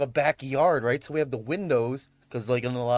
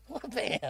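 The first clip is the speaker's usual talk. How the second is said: a quieter, fading "Wha-bam!"; softer punch sound echo; 9 semitones higher, 7 dB quieter than usual. Retold or imitated. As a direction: let the second hit trail off. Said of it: "fucking went".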